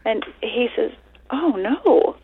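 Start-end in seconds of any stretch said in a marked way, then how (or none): 1.3-2.2 high, lightly mocked sympathy; mildly acted dismay, pitched up; concerned husband. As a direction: imitate a husband's puzzled concern. Husband reacts to the death.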